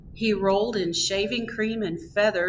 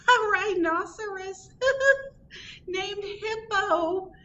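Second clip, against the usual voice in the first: high, slow words broken by a laugh; crying out laughing; 13 semitones higher than usual. A narrator's reading. Porcupine crying out the name, high and laughing. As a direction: squeal it through laughter. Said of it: "Fluffy cried".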